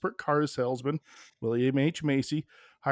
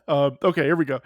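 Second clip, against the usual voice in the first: ordinary talk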